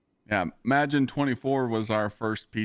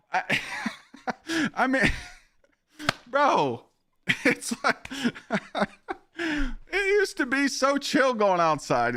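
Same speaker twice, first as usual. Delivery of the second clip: high laughing voice, slow at the end; 12 semitones higher, slower than usual. Real, not acted. Streamer laughing at his own rant about rude strangers, laughing. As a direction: laugh through the words.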